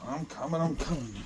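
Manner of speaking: quiet aside to himself on the way; muttered. Real, not acted. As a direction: say it under your breath while hurrying. Repeats under breath while walking.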